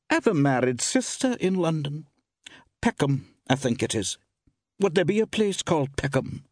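high woman's chatting voice; shopkeeper woman. A narrator's reading. Woman shopkeeper asks a traveller about her sister's district, high-pitched. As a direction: chat in a high female voice.

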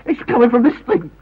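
high-pitched, urgent realisation; frightened insistence; alarmed. Acted. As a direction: warn them with rising dread. Realises the ticking is a bomb.

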